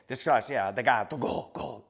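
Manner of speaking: a hammed-up Italian cursing out the cat; shooing the cat from the kitchen in fake Italian; mock Italian curse. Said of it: "I do curse at him in Italian".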